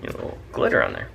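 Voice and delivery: quick unvoiced whisper. Real, not acted. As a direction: breathe it out fast under the breath. Aside while drawing glitter.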